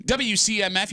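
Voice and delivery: ordinary talk, nothing marked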